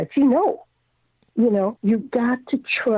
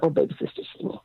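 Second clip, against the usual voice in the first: hushed sister's voice; 5 semitones lower, 6 dB quieter, faster than usual. Retold or imitated. Sister voiced in retold hospital story.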